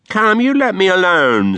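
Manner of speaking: put-on boy fending off his master's blows; protesting boy. A narrator's reading. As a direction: whine at the master to leave off.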